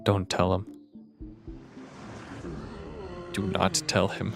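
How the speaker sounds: low, hushed warning. Real, not acted. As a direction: keep it down, don't let him hear. Hushed aside to partner.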